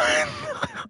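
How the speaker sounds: high squealing laugh; startled shriek of laughter. Real, not acted.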